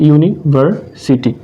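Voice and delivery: University in three separate chunks, ইউনি, ভার, city; split into syllables. Shown as a demonstration. Teacher demonstrating a trisyllable, university said in three spaced parts. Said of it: "যেটাকে তিন ভাগে ভাঙবো আমরা".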